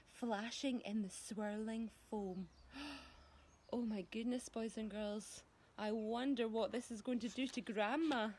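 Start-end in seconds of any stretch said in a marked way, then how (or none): 2.7-5.4 gasping surprise. Real, not acted. Reader gasps, aside to children.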